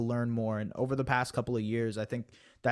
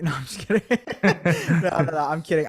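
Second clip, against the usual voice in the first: laughing, higher-pitched backpedal; 4 semitones higher, 7 dB louder, faster than usual. Real, not acted. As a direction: take back the joke through real laughter. Laughs off his own joke.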